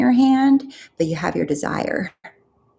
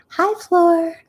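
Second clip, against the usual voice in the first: much higher mock greeting; mocking sing-song; 12 semitones higher than usual. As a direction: parody an annoying, chirpy greeter. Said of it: "tired of people saying".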